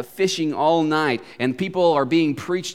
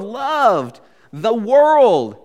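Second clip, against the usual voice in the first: LOVED and WORLD drawn out very long, raised and loud; emphasizing each word; 8 semitones higher, 7 dB louder, much slower than usual. Shown as a demonstration. Preacher dwelling on the verse, loved and world heavily stressed. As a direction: stretch loved, pause, then stretch world. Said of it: "each time emphasizing a different word".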